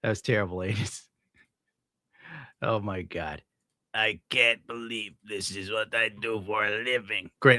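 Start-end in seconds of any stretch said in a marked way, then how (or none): 3.9-7.3 slow mock driver voice. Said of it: "talking like that for the rest".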